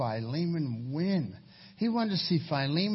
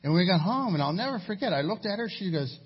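normal talking voice

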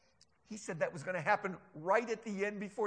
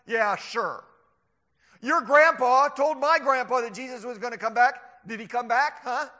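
loud mocking scoffer; scoffing; 4 semitones higher, 10 dB louder than usual. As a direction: taunt the believers, pressing for an answer. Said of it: "oh, they love to scoff".

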